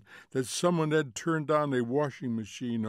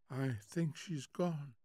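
slow, soft, sleepy voice; drowsy vagueness; 2 semitones higher, 9 dB quieter, slower than usual. A narrator's reading. Wife telling husband of the girl, slow and drowsy. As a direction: murmur it slowly from the pillow.

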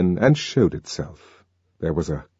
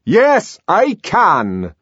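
loud, raised defiant answer; 12 semitones higher, 5 dB louder, much slower than usual. A narrator's reading. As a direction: throw it back at him loudly. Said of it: "in a very loud voice".

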